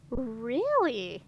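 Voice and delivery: intrigued, drawn-out really; a dragon queen intrigued by a claim, interested; great interest. A narrator's reading. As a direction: lean in, curious. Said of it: "in tones of great interest".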